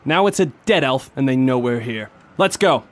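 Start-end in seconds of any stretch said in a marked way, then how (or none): 2.4-2.8 rallying call. Acted. Detective leads charge.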